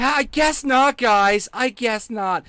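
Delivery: high, loud hammed-up concession; mock exclamation. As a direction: blurt it out high and theatrical to the audience. Repeats it in loud put-on voice.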